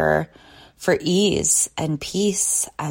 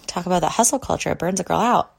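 usual speaking voice

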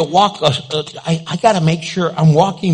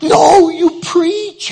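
high, slow starstruck reply; drawn-out starstruck correction; excited fan; 10 semitones higher, 3 dB louder, much slower than usual. Retold or imitated. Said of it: "she goes".

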